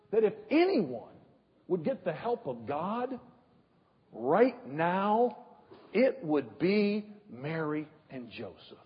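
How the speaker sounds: ordinary talk, nothing marked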